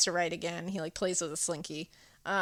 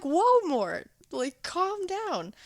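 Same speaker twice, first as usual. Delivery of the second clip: alarmed plea; 9 semitones higher, much slower than usual. Retold or imitated. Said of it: "And I was like".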